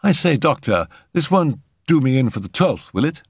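high-pitched, eager query; concerned young man. A narrator's reading. Arthur asking the doctor about the twelfth, concerned. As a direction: ask as if suddenly worried.

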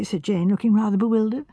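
usual speaking voice

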